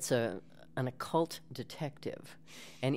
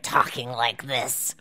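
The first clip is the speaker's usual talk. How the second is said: put-on witch voice, louder than usual talk; witchy voice; 6 dB louder, slower than usual. Shown as a demonstration. Demonstrating the film voice-over job to the hosts, witchy voice. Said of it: "doing a witchy voice".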